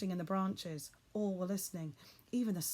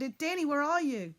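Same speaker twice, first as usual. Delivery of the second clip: loud, higher calling; shouting; 8 semitones higher, 7 dB louder than usual. A narrator's reading. Boy calling to his father through the trees, shouting. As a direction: shout the question across the wood. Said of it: "I shouted".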